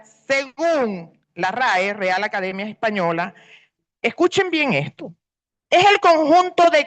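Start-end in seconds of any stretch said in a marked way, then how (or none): none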